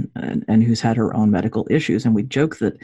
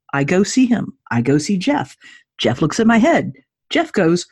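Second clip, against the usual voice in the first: normal talking voice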